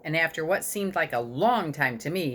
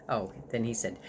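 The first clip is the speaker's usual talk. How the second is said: ordinary delivery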